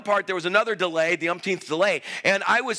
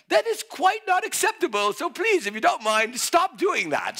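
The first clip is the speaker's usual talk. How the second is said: higher, mock-polite British lady; polite British attendant; 7 semitones higher than usual. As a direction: imitate a British stewardess politely objecting. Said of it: "She was so polite".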